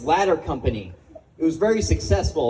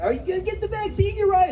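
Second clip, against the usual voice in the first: mock nosy question; 13 semitones higher than usual. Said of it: "Everyone's talking about vaccines".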